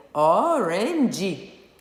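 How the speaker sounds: orange read the Portuguese way in three stretched syllables, o-ren-dji; slow Portuguese-accented "orange", ending in "dji". Shown as a demonstration. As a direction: say orange with a Brazilian Portuguese accent, sounding the final e. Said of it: "falando como a gente falaria em português, seria".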